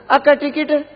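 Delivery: high-pitched ticket call; calling bus conductor. Acted. Conductor asks a woman passenger, high calling voice.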